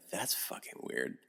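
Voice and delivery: very soft, near-whispered reaction; retold hushed disbelief. Friend reacts to the chameleon he pulls out, hushed disbelief. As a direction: say it under your breath.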